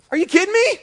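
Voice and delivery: high, loud disbelief; incredulous outburst. Acted. Preacher scoffs at hiding sin from God, incredulous.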